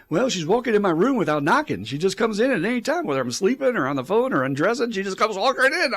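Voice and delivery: high, loud, swooping imitation of a complaint; mock exasperation, voice climbing and pushed; exasperated retold complaint. Imitating a patient griping about mom, exasperated complaint.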